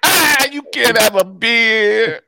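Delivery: put-on loud, high-pitched guy butting in on kids; hammed-up boisterous character voice; Joe Rocco Ragatino. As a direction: holler it high, stretching the words out. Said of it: "Joe Rocco Ragatino".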